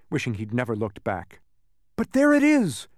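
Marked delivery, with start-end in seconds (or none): 2.0-2.9 high, loud cry of discovery; excited cry. Acted. Milo crying out to his friends on spotting the castle, thrilled. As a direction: call it out as you spot it. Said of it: "cried Milo".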